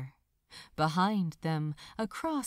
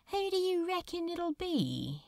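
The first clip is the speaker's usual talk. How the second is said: nonchalant; 9 semitones higher than usual. Acted. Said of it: "asked Crowley nonchalantly".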